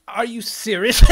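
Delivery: exasperated outcry. Retold or imitated. Yelling at the internet in fed-up disbelief. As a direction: throw the question out in exasperation.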